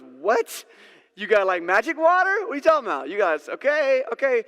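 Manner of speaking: loud, high, disbelieving; mocking woman laughing at magic water; incredulous laughing woman. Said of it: "just started laughing out loud".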